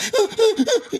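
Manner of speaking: high-pitched cackle of a laugh; high mock laugh inside the quote.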